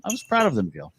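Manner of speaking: high, proud mock boast; mock pride. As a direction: boast it, then fade.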